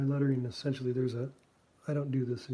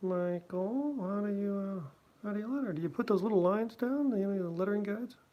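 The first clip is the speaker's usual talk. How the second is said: higher voice voicing Schulz asking; raised mock voice for Schulz; 6 semitones higher, 3 dB louder than usual. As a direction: play the older cartoonist quizzing him. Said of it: "But he said".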